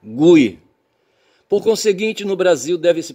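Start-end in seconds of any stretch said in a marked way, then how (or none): 0.0-0.6 Gui as a loud "GOO-ee"; Gui like Rui. Shown as a demonstration. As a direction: punch Gui with the U sounded. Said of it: "tem a palavra Rui". (Gui forte, rimando com Rui)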